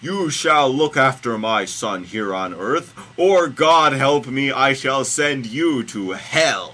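loud, slow shouted threat; God in capital letters. A narrator's reading. Host reading God threatening Mary, bellowing. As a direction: bellow the warning word by word. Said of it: "it's all in capital letters".

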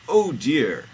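high, loud "oh dear"; hammed-up sun-god alarm; comic dismay. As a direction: exclaim it big in mock dismay. Ra's dismay in story.

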